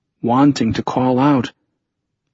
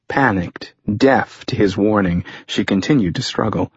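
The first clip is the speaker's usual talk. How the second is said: ordinary delivery, nothing marked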